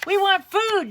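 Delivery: high, loud demand; soldiers demanding. Acted. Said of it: "demanded all the soldiers".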